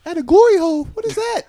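put-on high, loud little kid; squeaky boy asking mom; child voice. Voicing a young son.

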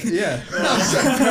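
laughing through the words; slow, loud laughing admission. Real, not acted. Joking about crying, laughing.

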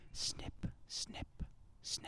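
whispered snip sounds; ASMR whisper. Shown as a demonstration. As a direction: breathe the snips, ASMR style.

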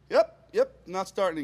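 high, overly chipper reply; fake-casual yep, jumpy and high; nervous agreement.

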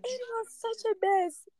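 very high, drawn-out squeak; comic lament. Real, not acted. Telling her friend about the morning after crying, squeaky lament. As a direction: wail it softly at a squeaky pitch.

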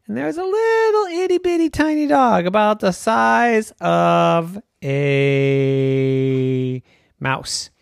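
high squeak then slow drawl; tiny reveal. A narrator's reading. Storyteller revealing the itty bitty dog, high then slow.

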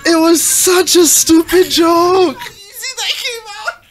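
high, slow wail through laughter; real laughing exasperation, stretched out; helpless laughing cry. Real, not acted. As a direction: cry it out while cracking up. Cracking up over a silly joke.

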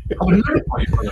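high, fast, protesting disbelief; shocked denial. Retold or imitated. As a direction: recoil in mock horror at the charge. Accused of insulting his mother.

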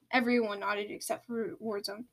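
usual speaking voice

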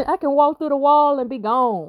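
high, fast taunting voice with a laugh; mocking demon. Demon taunting a human who fights it, high mocking laugh. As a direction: sneer it as a cocky spirit, laughing at the end. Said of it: "the demons be laughing because".

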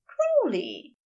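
high call of his name; high, eager call. Acted. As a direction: Aziraphale calling out across the stream, high. Aziraphale calls out.

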